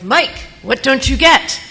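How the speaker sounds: slow, loud, exasperated retort. Retold or imitated. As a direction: spell it out to a dense coworker, annoyed.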